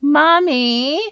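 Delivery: put-on British little girl's syrupy baby voice; sweet baby voice. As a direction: whine it up high like a small child asking mommy. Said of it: "they speak in these kind of sweet baby voices".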